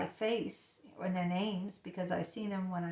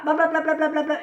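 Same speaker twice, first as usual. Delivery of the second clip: loud, raised mock jabbering; nonstop hen chatter; 8 semitones higher, 10 dB louder, faster than usual. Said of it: "those hens that can't stop".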